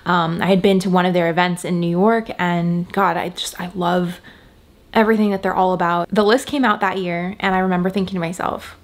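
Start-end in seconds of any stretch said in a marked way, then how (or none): none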